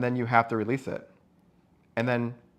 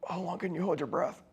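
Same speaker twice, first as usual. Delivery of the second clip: ordinary talk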